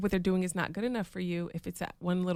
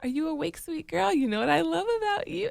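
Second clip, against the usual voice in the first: higher, tender wake-up call to a daughter; sweet wake-up voice; 10 semitones higher than usual. Retold or imitated. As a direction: coo her awake gently. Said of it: "so sweet".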